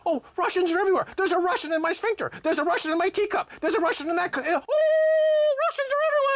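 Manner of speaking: a medal-wearing psychopath losing it over Russians; hysterical general. Retold or imitated. Mocking military brass with shrill, frantic mock panic. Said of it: "they're saying".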